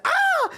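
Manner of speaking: very high, loud imitated shriek; mock scream. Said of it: "the second scream".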